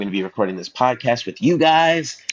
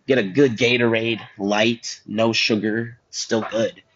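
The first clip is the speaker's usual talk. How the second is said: normal talking voice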